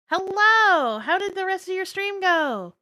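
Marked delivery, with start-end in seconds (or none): none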